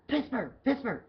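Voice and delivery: high, urgent call across the bar. Retold or imitated. Manager calling the bartender over in a rush, high urgent call. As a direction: call her name to hurry her over.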